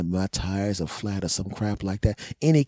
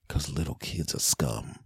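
ordinary talk

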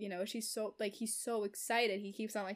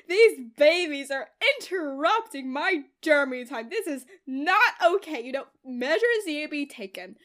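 loud hammed-up jealous wife; imitating a possessive wife, outraged; mock indignant; 2 semitones higher, 10 dB louder than usual. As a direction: declare it like a petty villain. Verity scheming against the babies.

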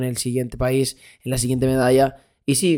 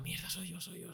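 stunned whisper; 3 semitones higher, 19 dB quieter than usual. Retold or imitated. Seeing himself on stadium screens.

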